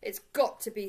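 loud, insistent cry; cried out. A narrator's reading. Jonathan insisting it must be Paddington, cried out. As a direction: cry it out with force on "got". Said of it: "cried Jonathan".

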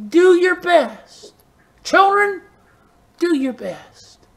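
"Do your best" lifted high and slowed right down; high drawn-out exhortation. Retold or imitated. Preacher urging the children, high and slow. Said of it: "she would always tell me".

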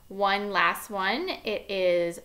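ordinary delivery

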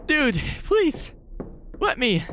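usual speaking voice

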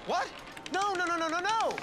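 high, loud desperate nos; panicked shouting. Acted. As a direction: yell in panic at the bet.

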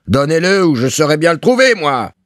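high, threatening yell; clamouring shout. A narrator's reading. Landlord threatening to find the money himself, shouting. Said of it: "clamait Gorgerin".

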